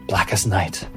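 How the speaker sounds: ordinary talk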